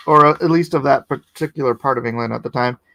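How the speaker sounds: ordinary talk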